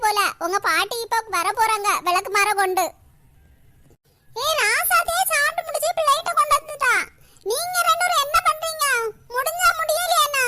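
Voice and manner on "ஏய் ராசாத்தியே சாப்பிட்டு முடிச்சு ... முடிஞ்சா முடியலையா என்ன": a higher grandmother voice, nagging; scolding grandmother. Acted. (திட்டும் பாட்டி குரல்)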